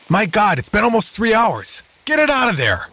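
high, frantic alarmed cry; panicked shout. A narrator's reading. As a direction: yell it in sheer panic. Scientist panics at shark.

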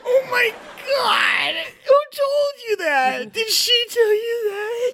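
high, wailing, sobbing crier; sobbing town crier. Acted. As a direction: cry out like a betrayed herald. Crier distraught about wife.

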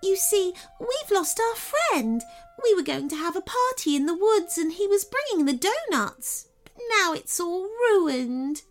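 a heartbroken tiny fairy lamenting lost donuts; sad fairy. Acted. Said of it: "she said".

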